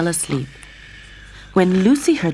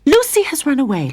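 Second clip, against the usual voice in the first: angry; 10 semitones higher, 4 dB louder than usual. A narrator's reading. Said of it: "Lorraine said angrily".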